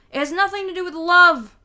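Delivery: loud high insistent denial; angry denial. A narrator's reading. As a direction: snap it back loudly. Peter angrily denies.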